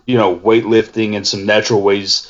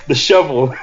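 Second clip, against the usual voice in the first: higher, laughing as it comes out; cracking up; 5 semitones higher than usual. Real, not acted. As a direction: barely get it out for giggling. Cracks up at the shovel joke.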